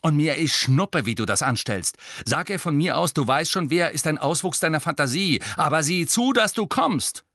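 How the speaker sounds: high and loud, ending in a yell; furious order. A narrator's reading. Angelina orders Harry to come, furious. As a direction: demand it without mercy. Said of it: "sagte Angelina wütend".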